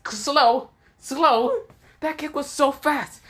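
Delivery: high, disbelieving cry of "slow?"; incredulous crowd. Acted. Everyone protesting that the kick was fast, incredulous. Everyone reacts to Naruto's taunt.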